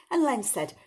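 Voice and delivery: ordinary delivery, nothing marked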